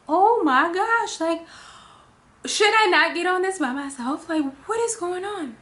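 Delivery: high, loud, drawn-out panicked whine; mock panic; alarmed. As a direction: fret out loud in disbelief. Alarmed by attendant remark.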